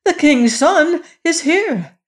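very high, loud exclamation; crying out. A narrator's reading. Queen to gaolers at the trap door, crying out. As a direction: shout it up urgently, pitch soaring. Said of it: "cried the queen".

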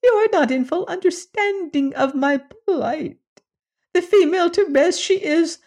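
a tiny creature pining for his wife; lamenting little alien. Acted. Alien lamenting his lost spouse.

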